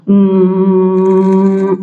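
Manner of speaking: long steady vibrating "mmm"; cow-like hum. Shown as a demonstration. Coach showing the vibration exercise, cow-like hum. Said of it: "vamos a decir como una vaca".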